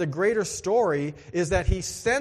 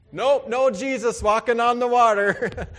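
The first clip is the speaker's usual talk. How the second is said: laughing, louder; 6 semitones higher, 6 dB louder than usual. Real, not acted. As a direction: chuckle through the punchline. Laughing at own boat story.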